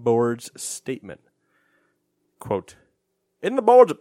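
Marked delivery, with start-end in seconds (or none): 3.4-3.9 a badly faked Australian accent, much higher and louder; botched Australian accent; failed Australian accent. Shown as a demonstration. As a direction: try an Australian accent and miss. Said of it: "that's not Australian at all".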